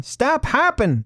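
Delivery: high, drawn-out scolding command; slow, high-pitched mock order; mock trainer command. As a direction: play an exasperated obedience trainer. Acts out an obedience trainer's command.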